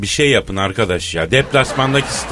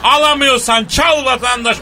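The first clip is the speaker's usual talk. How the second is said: town crier; 4 semitones higher than usual. Acted. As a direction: hawk it like a bazaar crier. S0 opens show like a crier. (tellal gibi bağırarak)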